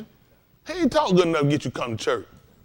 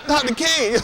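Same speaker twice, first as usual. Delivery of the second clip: high, breathy-free swoon on the name; mild mock swoon; swooning church lady; 9 semitones higher, slower than usual. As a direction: coo his name like a smitten fan. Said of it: "You're like".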